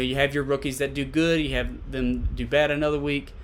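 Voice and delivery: ordinary delivery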